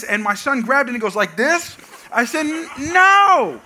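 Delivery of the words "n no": high, loud parental no; exasperated yell. Retold or imitated. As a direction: burst out correcting a child, exaggerated. Retold exasperated correction of son.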